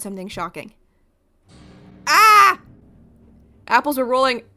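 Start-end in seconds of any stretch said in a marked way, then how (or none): 2.1-2.6 sudden high loud yelp of shock; startled shriek at the mess; shocked cry. Acted. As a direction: gasp out a startled scream. Shocked at apples everywhere.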